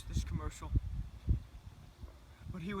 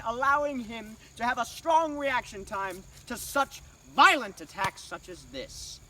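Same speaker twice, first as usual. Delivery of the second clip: ordinary talk, nothing marked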